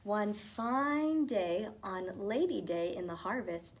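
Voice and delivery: plain reading voice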